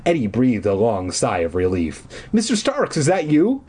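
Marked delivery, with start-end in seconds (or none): 2.3-3.6 calling out. A narrator's reading. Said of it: "I called down".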